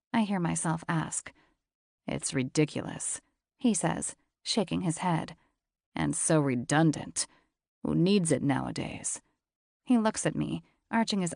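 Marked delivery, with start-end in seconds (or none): 2.1-3.2 lower, husky-free steady man's voice; low male voice. A narrator's reading. 5.9-9.2 lower, husky-free steady man's voice; low male voice. A narrator's reading.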